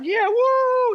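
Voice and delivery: very high, loud sideline whoop; imitated cheering yell. Dad following a touchdown run down the sidelines, imitated high yell. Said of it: "he goes yelling".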